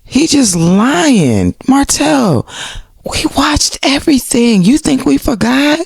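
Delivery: high, drawn-out scolding; mock outrage. Scolding Martell for his lying, mock outrage. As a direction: call him out in a high, stretched voice.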